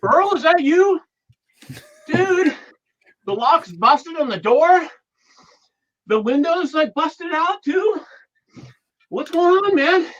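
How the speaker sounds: indignant at the intruder; alarmed and upset. Acted. Acted scene catching Earl inside.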